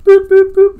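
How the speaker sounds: very high, loud beeps; silly beeping. Retold or imitated. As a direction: squeak the boops like a toy. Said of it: "be like".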